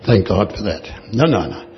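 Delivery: usual speaking voice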